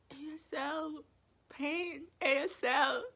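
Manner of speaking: high, tearful pleading; crying plea. Retold or imitated. Patient waking from surgery, tearfully pleading with the nurse. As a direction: beg through tears, half awake.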